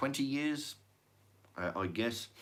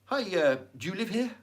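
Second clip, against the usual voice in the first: mock inspector; 9 semitones higher than usual. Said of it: "first thing out of the mouth".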